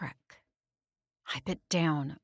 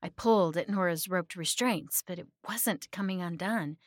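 ordinary delivery, nothing marked

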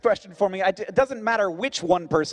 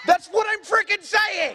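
high-pitched exasperated yell; exasperated shout; 13 semitones higher than usual. Real, not acted. Host vindicated by audience member's pronunciation, exasperated shout. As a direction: burst out in comic exasperation. Exasperated outburst after hearing same word.

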